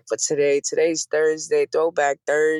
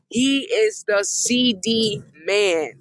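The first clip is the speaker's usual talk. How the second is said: slow dramatic; 3 semitones higher, much slower than usual. Acted. Hype reveal of the C D man.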